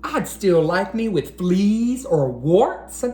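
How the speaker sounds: lively rhyming picture-book read-aloud; reading to children. Acted. Teacher reading a rhyming book to boys and girls, storytime read-aloud. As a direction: read each rhyme out to a room of young kids.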